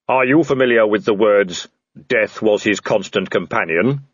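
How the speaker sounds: level, booming supernatural figure; Death. Acted. Death addressing the man in the tree, solemn intoning. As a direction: intone it evenly, without hurry.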